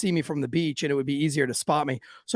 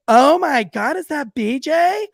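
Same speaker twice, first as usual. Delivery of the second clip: high, squealing surprise rising at the end; excited girls; 8 semitones higher, 4 dB louder, slower than usual. Retold or imitated. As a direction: mimic thrilled girls spotting a friend. Three girls greet friend excitedly.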